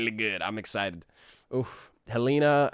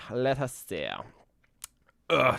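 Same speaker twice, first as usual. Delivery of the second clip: ordinary talk, nothing marked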